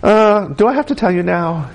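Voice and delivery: put-on reluctant kid. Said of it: "He goes".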